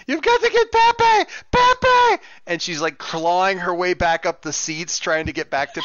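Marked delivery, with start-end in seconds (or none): 0.1-2.2 very high, loud hammed-up shriek for help; panicking Dominican grandmother. Freaking-out grandmother calling for her chihuahua, panicked shriek. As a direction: wail in a frantic granny's high-pitched voice. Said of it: "panicking Dominican grandmother".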